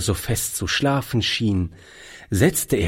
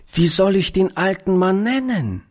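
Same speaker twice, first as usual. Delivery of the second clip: put-on high-pitched little girl's question; small child asking shyly in a high voice; 9 semitones higher than usual. A narrator's reading. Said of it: "Sie warf einen verstohlenen Blick".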